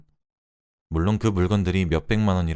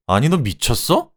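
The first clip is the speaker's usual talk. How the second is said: raised, higher-pitched disbelief, slowed down; indignant shout; 6 semitones higher, 5 dB louder than usual. A narrator's reading. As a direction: shout back in outrage as the husband. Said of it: "라며 소리쳤죠". (어이없어하며 소리치기)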